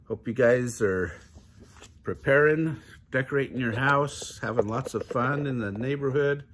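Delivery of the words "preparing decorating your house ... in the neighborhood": plain reading voice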